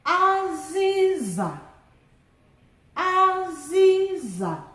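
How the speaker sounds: Aziza drawn out slowly and high; teacher modelling Aziza slowly; slow Igbo word. Shown as a demonstration. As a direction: sound out Aziza, high tones held. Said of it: "let's do the tone marks together".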